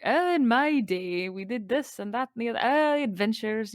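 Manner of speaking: acted wistful old-timer looking back; wistful Scrooge. Fan imitating Scrooge recalling his adventures, wistfully. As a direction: play at fond reminiscing, drawing out the opening. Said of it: "kind of wistful, like".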